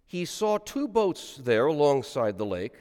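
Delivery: solemn liturgical reading aloud; gospel reading. Acted. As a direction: intone the gospel for the congregation.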